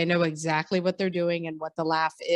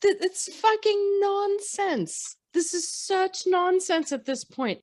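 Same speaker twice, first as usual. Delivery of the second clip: high-pitched, drawn-out exasperation; real incredulous rant, pitched way up; exasperated; 12 semitones higher, slower than usual. Real, not acted. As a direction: stretch it out in sheer disbelief. Eye-rolling rant at the characters' stupidity.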